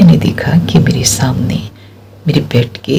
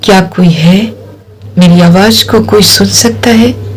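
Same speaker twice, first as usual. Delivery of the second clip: raised, slightly louder call for help; retold louder call, still frightened; a bit louder; 5 semitones higher than usual. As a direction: push the voice out a little more this time. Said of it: "थोड़ा ज़ोर से मैंने बोला".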